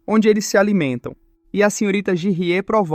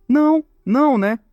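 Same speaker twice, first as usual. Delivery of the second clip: mocking; 5 semitones higher than usual. Instructor mocking, provoking.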